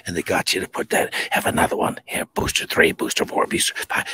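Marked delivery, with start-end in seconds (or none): 1.3-4.1 whispered, sly sales pitch; mock whispered offer. Mocking health officials pushing boosters, in a sly whisper. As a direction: hiss the offers like a pusher.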